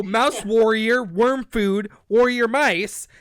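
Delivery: loud, slow, choppy sparrow talk; halting caveman-style bird voice; broken-English sparrow. Acted. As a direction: punch each word like a simple-minded bird. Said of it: "They speak like Broke Brain".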